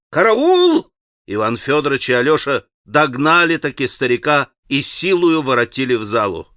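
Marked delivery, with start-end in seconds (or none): 0.1-0.9 a high cry for help; panicked call. A narrator's reading. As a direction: yell it out in alarm. Old man cries for help.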